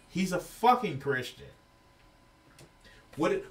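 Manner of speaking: ordinary talk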